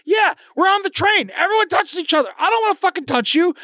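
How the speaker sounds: high, shouted retort; retold subway argument, shouted high; retold shouting. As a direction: yell it back like on the train. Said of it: "I was like".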